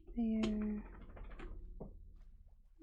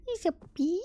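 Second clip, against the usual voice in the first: delighted gasp; 6 semitones higher, much faster than usual. Real, not acted. Gasps at baby camel.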